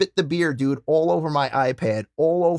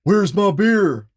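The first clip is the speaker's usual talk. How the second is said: loud, slow, higher bellow; mock Stone Cold call; 5 semitones higher, 6 dB louder, much slower than usual. Copying Stone Cold during the match, slow bellowed call. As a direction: bellow it like a wrestler toasting. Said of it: "I said".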